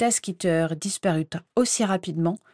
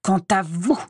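barely held rage; 3 dB louder than usual. Acted. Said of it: "éructa-t-il".

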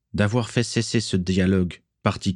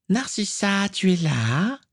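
put-on much higher grating girl's voice; 12 semitones higher, slower than usual. A narrator's reading. A young woman interrupts Narcissa, much higher grating call. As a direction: screech her name from close by. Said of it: "dit une voix grinçante".

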